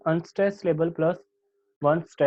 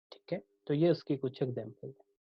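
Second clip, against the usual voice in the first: normal talking voice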